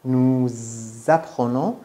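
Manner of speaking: "Nous apprenons" with a long buzzed z linking the words; liaison Z. Shown as a demonstration. Teacher showing where the z comes from, stretched liaison in "Nous apprenons". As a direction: hold the z between "Nous" and "apprenons".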